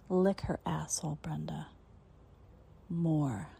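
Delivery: commanding whisper. Acted. Mistress giving orders.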